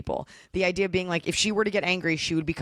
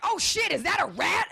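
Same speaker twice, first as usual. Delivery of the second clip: surprised shout; 13 semitones higher than usual. Retold or imitated. Startled quote at airport.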